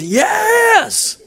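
very high shouted yes; evangelist shout. Retold or imitated. Evangelist demanding an answer from the crowd, very high. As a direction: throw it up with full revival fervor. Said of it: "The evangelist goes".

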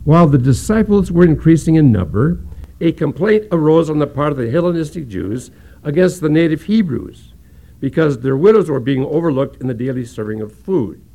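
loud, measured reading of the passage; scripture reading. Acted. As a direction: proclaim the verses to the congregation. Reads Acts six aloud.

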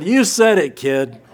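real laughter on the words; laughing. Real, not acted. Laughing reply to the boy.

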